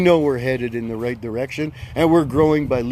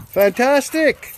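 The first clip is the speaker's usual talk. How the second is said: Fantastic drawn out slowly, pitched much higher; enthusiastic praise; 10 semitones higher, 4 dB louder, slower than usual. Real, not acted. Host praising a returning rally supporter, drawn-out and high. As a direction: stretch out Fantastic high and slow, gushing.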